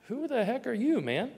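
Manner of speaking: irritated who-are-you challenge; frustrated retort. Pretend. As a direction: snap back at the pushy stranger. Frustrated at the young man.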